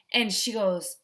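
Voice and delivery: usual speaking voice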